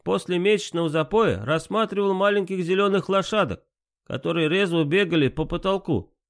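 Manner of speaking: put-on light Georgian accent; hint of a Georgian accent. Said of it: "с характерным акцентом".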